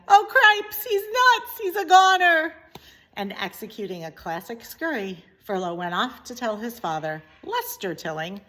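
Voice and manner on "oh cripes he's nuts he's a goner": high, loud frantic mouse cry; panicked shout. Acted. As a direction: shout it in alarm, breathless. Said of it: "shouted Furlow again".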